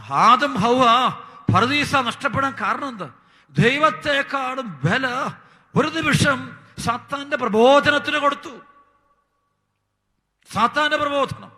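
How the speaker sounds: high, loud pulpit fervour; fiery preaching. Acted. Preacher warning the congregation about Satan, fiery preaching. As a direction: thunder it from the pulpit.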